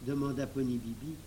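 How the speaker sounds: usual speaking voice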